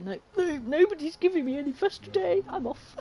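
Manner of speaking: high-pitched, put-out cat voice; playful high voice for a fussed-over pet; voicing the cat. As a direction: speak up high for a cat in a huff. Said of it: "Just decided".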